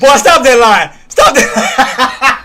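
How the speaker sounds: loud laughing protest. Real, not acted. Streamer laughing at a viewer's joke, laughing protest. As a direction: laugh through a mock scold.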